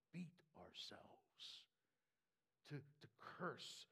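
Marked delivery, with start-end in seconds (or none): 0.1-1.6 slow hushed whisper on beat ourselves. Real, not acted. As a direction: hush it right down, slowly. Mocking self-punishment, whispered.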